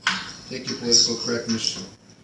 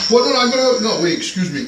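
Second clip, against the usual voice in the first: flustered outburst; 8 semitones higher, 7 dB louder than usual. Retold or imitated. Mock flustered self-quote at window.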